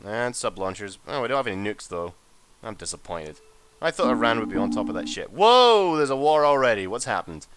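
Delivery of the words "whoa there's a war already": loud, high whoa of real surprise; startled and loud; startled outburst. Real, not acted. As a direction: burst out at sudden news, then ease down. War suddenly appears in game.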